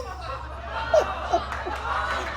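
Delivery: high breathy giggle. Real, not acted. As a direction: let out a giggle. Laughing at her mistake.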